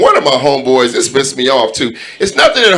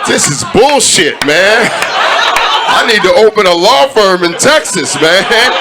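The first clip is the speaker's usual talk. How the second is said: laughing rant; 4 semitones higher than usual. Retold or imitated.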